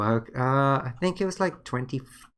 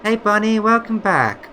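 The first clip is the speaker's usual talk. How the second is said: much higher, louder cheerful hello; excited greeting; 10 semitones higher, 9 dB louder than usual. Real, not acted. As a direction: greet the viewer with a burst of excitement. Greeting a viewer on stream.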